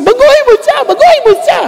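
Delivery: high insistent demand; whining demand, repeated; demanding plea. Retold or imitated. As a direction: insist God fix her, pitched high. Demanding God change the wife.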